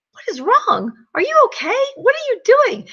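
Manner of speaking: high, startled questions; alarmed husband. Retold or imitated. Husband finds wife on the floor, high and alarmed. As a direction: imitate the husband's worried alarm. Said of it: "he's like".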